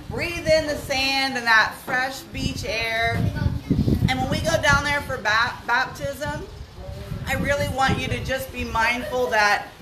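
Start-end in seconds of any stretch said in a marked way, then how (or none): none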